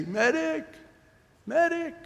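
very high-pitched distant call for help; acted, drawn-up scream for aid; wounded man's cry. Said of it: "hear a guy who's wounded say".

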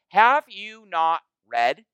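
a hint of Jesus rebuking the scholars; pointed rebuke. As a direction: let each word land slowly. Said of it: "He goes like this".

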